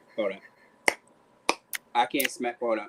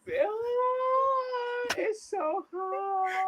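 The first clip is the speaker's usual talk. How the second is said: very high, drawn-out wail; hammed-up grief; fake crying; much slower than usual. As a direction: stretch it into a mock sob.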